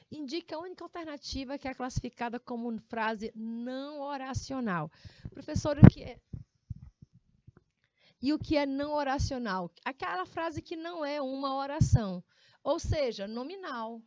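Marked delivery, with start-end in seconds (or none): 5.3-9.7 mock student voice.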